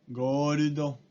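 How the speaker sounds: gordo slowly, go-ru-do rolled together; Japanese loanword. Shown as a demonstration. Teacher demonstrating gold in Japanese: ゴールド. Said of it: "gold se vuelve en gordo".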